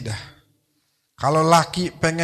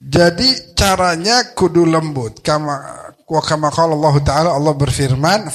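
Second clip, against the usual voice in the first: usual speaking voice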